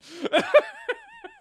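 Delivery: real high burst of laughter. Real, not acted. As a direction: laugh out loud at the joke. Friend cracks a joke.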